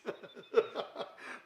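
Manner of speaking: high breathy laugh at the comment; real amused laugh; burst of laughter. Real, not acted. Laughing at viewer's accent comment.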